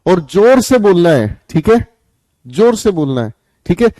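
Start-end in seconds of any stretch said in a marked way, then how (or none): none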